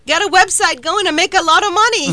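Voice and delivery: high, loud incredulous rant; hammed-up indignant exclaim; mock outrage. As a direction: play up the disbelief, half joking. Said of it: "And I'm like".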